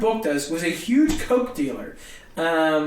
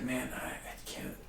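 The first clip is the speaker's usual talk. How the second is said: unvoiced, breathy exasperated whisper; 6 semitones lower, 18 dB quieter than usual. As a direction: breathe it out in defeat.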